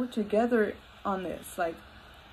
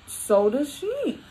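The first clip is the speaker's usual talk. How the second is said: pointed retort; 7 semitones higher than usual. Retold or imitated. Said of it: "And I'm like".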